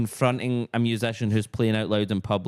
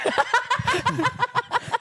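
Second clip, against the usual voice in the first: high squeal laugh. Real, not acted. Dream punchline laugh.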